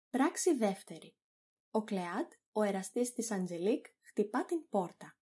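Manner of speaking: ordinary delivery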